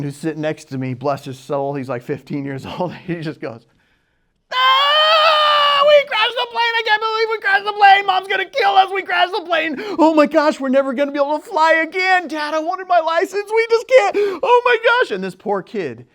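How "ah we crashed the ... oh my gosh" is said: high-pitched screaming teenage freak-out, imitated; shrieky, loud, hammed-up meltdown; manic panic. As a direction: mimic a hysterical kid wailing at top pitch. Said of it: "is going totally manic".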